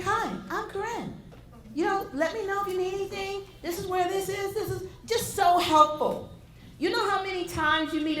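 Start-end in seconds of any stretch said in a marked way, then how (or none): 0.0-4.9 mock British accent, higher and softer; a light, bubbly British welcome. Imitating the British colleague's cheerful greeting in a British accent. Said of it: "with a British accent".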